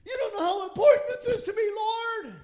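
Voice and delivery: high, loud, fast outcry; urgent pleading. Acted. Believer begs God to grasp how much it matters, urgent pleading. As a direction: plead frantically at full voice, hammed up.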